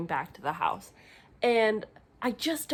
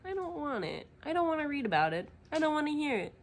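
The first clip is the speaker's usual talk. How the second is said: higher, fast, fed up; whiny protest; 7 semitones higher, faster than usual. Real, not acted. Protesting animal cruelty.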